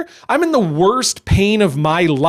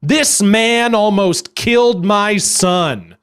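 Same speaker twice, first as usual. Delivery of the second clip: slow, every word hit hard; all caps; 2 semitones higher, much slower than usual. Retold or imitated. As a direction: read it out slow and heavy as if in capitals. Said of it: "all caps".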